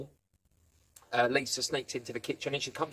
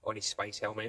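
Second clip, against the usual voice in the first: usual speaking voice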